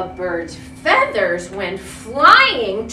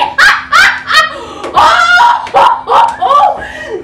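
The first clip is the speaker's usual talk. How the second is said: loud high belly laugh; big elephant laugh; 13 dB louder than usual. Acted. Elephant laughing at the funniest thing, loud laugh. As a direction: let the laugh burst out. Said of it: "he began to giggle".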